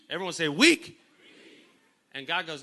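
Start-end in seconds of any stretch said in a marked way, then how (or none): 0.5-1.0 "Weak" yelped high and loud; exaggerated emphasis. Shown as a demonstration. Preacher getting everyone to echo Gideon, "Weak" shouted high. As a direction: punch "Weak" upward in a loud burst.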